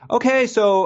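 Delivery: high, loud bubbly greeting; chirpy theater staff; perky usher voice. Retold or imitated. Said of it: "she was like".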